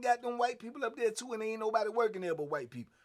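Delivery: ordinary talk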